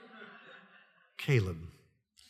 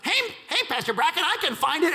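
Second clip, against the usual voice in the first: put-on much higher, eager child's voice; eager little kid; 14 semitones higher than usual. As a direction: squeak it out like a keen kid. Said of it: "this precious kid's like".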